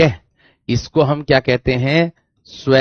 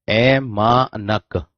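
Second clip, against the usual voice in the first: ऐमानक drawn out very slowly, ऐ, मा, नक; syllable by syllable; 2 semitones lower, 3 dB quieter, much slower than usual. Shown as a demonstration. Teacher writing out the term for students, ऐमानक slowly syllable by syllable. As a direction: stretch each syllable of ऐमानक apart.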